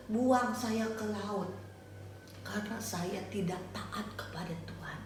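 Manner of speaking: guilty whispered confession. Acted. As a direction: confess it softly, dropping to a whisper. Yunus confessing his disobedience.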